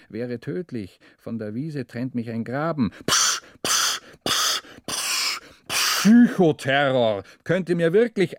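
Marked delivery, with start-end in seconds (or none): 3.1-5.4 voiceless puffing of effort; panting breaths. Acted. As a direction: puff it out, short and hard. Cyclist panting in traffic.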